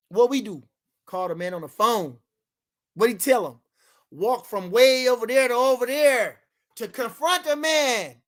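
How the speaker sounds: ordinary talk, nothing marked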